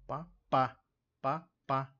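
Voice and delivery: "pá, pá" with the second PA punched loud; pa-PA, hammer the last syllable of "pá, pá"; stress on final syllable. Shown as a demonstration. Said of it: "com a sílaba tônica no final". ("pá, pá" com tônica no final)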